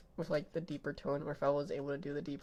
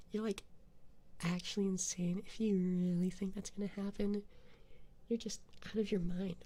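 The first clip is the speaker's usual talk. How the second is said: put-on Valley Girl accent. Taunting chat in a Valley Girl voice. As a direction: play a sassy Valley Girl, lightly. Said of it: "put on a more, uh, Valley Girl Gato accent".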